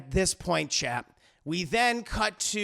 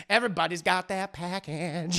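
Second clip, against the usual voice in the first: put-on pimp voice with laughter; hammed-up pimp, breaking into a laugh; pimp character. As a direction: deliver the closing line as a pimp. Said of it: "the pimp's like".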